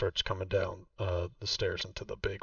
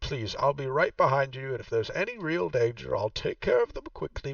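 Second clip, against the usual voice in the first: game master voicing Vaku, noticeably higher; Vaku's voice; 6 semitones higher, 4 dB louder than usual. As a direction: play the proud host showing her old home. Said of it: "She says".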